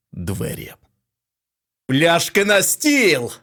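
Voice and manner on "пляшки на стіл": loud, slow festive call; exclaimed. A narrator's reading. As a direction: shout for the bottles to celebrate. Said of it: "вигукнув Кассиді".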